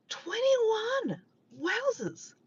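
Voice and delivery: high, comic shock at the cold; mock shock. Real, not acted. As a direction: gasp it out like it's freezing.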